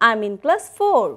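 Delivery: ordinary talk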